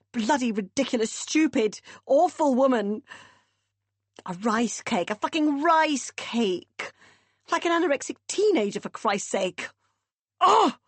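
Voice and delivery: loud, high, building rage ending in a shout; furious rant. A narrator's reading. Meg raging at her mother while bashing the wheel, furious. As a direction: let the fury boil over and shout. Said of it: "She shouted out again".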